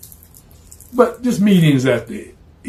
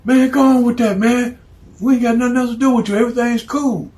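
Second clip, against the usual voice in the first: brushing him off; 9 semitones higher than usual. Acted. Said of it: "They were like".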